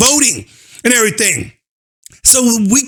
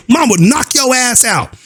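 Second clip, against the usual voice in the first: ordinary talk, nothing marked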